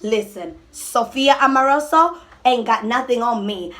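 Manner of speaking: ordinary talk, nothing marked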